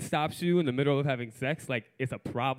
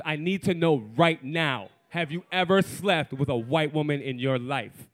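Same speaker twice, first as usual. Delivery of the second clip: insistent demand. Retold or imitated. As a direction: press for an answer, weighting each key word. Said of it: "She goes".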